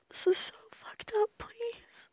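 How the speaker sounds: high, unvoiced, breaking into tears; crying whisper.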